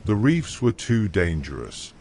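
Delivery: plain reading voice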